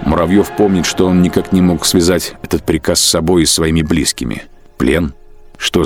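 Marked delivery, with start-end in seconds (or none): none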